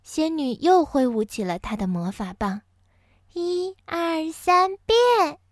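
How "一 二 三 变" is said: 仙女念咒. A narrator's reading. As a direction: build the spell up to the last word. 仙女第三次施魔法. (仙女念咒，由轻到响，音调很高)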